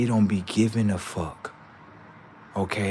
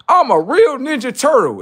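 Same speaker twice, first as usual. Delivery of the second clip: high, loud mock boast; high sarcastic impression of a wannabe hero; mocking quote; 10 dB louder than usual. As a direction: sneer it like a clueless poser. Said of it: "talking about something".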